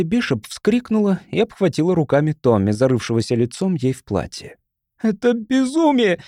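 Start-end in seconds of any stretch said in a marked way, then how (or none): 5.0-6.2 high, drawn-out protest; indignant. A narrator's reading. Mother holding Tommy protests to the crowd, indignant. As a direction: protest in outrage. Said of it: "негодующе бросила она повернувшимся к ней людям".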